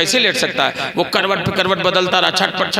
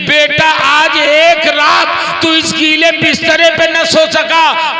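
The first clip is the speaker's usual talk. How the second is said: mother's plea building from raised voice to high, slow shout; impassioned preaching; 8 semitones higher, 6 dB louder than usual. Acted. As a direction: declaim with mounting fervour, stretching words at the climax. (जोशीली तकरीर, चढ़ती ऊँची पुकार)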